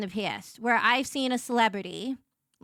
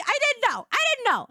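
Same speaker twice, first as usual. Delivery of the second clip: high embarrassed protest; 11 semitones higher, 4 dB louder than usual. Real, not acted.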